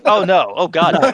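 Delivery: appalled outcry. Real, not acted. Cohost reacting to Granny Goodness in a bikini, loudly appalled. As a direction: recoil loudly at an awful image.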